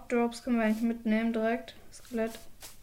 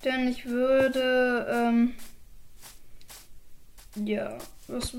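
trailing off; much slower than usual. Real, not acted. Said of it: "jetzt hab ich vergessen".

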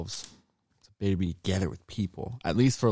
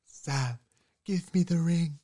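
Frodo voice; 7 semitones higher, slower than usual. Acted. Frodo impression repeated.

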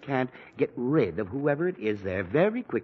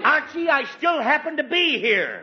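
high, loud offended retort; indignant; 12 semitones higher, 5 dB louder than usual. Acted. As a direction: catch him out. Father catches insult.

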